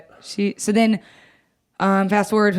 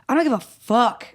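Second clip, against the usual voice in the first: higher, exasperated retold snap; pissed-off retort; 6 semitones higher than usual. Daughter retorts to Donna on the phone, pissed off. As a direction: act out being fed up on the phone. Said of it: "and I was pissed".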